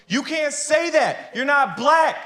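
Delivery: high, slow, scolding woman's voice; mock indignant woman. Pakistani woman scolding the comic, high and slow. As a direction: scold him like an offended auntie. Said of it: "This Pakistani woman gets up and goes".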